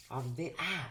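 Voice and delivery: ordinary talk, nothing marked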